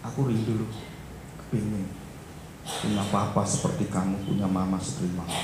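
put-on soft, low child's confession; retold wistful longing. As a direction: confide it quietly, pitched low.

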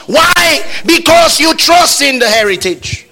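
loud, high-pitched sermon declaration; full-force preaching. Real, not acted. Preacher urging congregation not to be feeble, full-force preaching.

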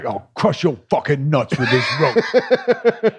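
slow Cockney threat; mock Cockney Bond villain menacing his captive; Bob Hoskins.

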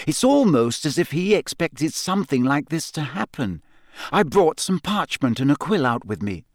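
put-on a clever girl reasoning it out; Hermione voice. A narrator's reading.

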